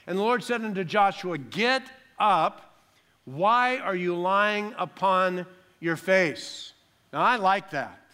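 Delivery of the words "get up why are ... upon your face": loud, raised, slow divine command; commanding rebuke. Acted. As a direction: order him up, drawing out the question. God's rebuke to Joshua, acted.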